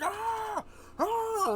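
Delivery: high, loud pained screams; hammed-up cries of pain; giant screaming. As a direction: shriek like an arrow just hit your eye. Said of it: "And he's like".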